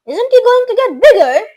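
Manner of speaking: high, loud worried shout. A narrator's reading. Father shouts in alarm about his tiny son. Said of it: "shouted Mister T V".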